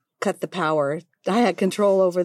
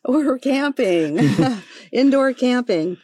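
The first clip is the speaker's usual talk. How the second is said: slow, higher, laughing through it; laughing sheepish reply; 7 semitones higher, 4 dB louder, slower than usual. Retold or imitated. Wife retelling her answer to the surprised husband, laughing. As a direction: confess it with a giggle. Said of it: "And she said".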